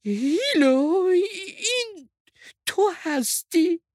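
high, slow calling out, full of doubt; hesitant call. A narrator's reading. Sheep calls doubtfully to Hilo on hearing the voice, hesitant call. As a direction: call out uncertainly, drawing the words out. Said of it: "با تردید داد زد".

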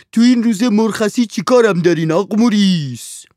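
high, loud whining servant's voice. A narrator's reading. Servant Handsome answers his master, high and whining. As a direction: complain at being called on your day off.

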